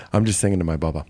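low impression of a spiritual teacher; lightly voiced guru, offhand and low. Guru answering an audience member, low offhand put-on voice. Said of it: "You wanna hear my K D impression?".

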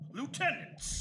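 snapping at the officers with a rising end; clipped and impatient, demanding an answer. Acted.

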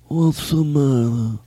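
slow, mumbled mob boss voice; Godfather impersonation. Pretend. Host slipping into a slow mumbled Godfather voice. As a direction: mumble it like an old mafia don. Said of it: "Godfather impersonations".

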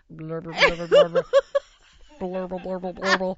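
gurgling blur sounds, rhythmic; stomach gurgle noises. Retold or imitated. Teller mimicking Heidi's rumbling stomach, gurgling sound effect. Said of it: "her little mouse stomach going".

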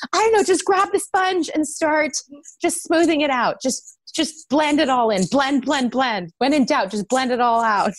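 high mock coaching voice.

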